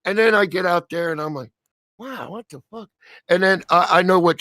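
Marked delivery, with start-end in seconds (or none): 2.0-2.9 hushed, higher-pitched disbelief; stunned aside. Retold or imitated. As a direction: say it small and soft, as if stunned. Retelling stunned reaction once outside.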